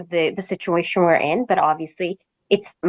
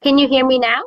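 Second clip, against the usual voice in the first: louder and higher, checking the line; calling out; 6 semitones higher, 5 dB louder than usual. Real, not acted. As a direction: raise your voice to get through. Checking audio on a choppy call.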